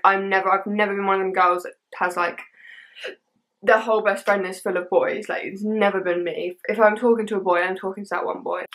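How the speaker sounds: usual speaking voice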